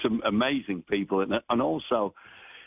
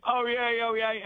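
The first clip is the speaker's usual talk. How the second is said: much higher, ringing "Oh, yay"; crier's call. Shown as a demonstration. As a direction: call it out over the arriving train. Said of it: "I'm doing the".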